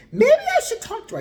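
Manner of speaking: squeaky goofy suggestion; mock boyfriend. Narrator imitating Steve proposing to talk to the woman, high laughing mock voice.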